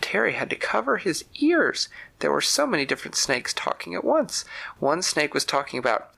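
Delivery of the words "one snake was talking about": plain reading voice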